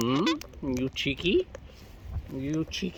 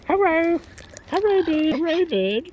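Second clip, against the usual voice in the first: sing-song hello; 11 semitones higher than usual. Real, not acted. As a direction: coo a bright hello up high. Greeting birds in sing-song.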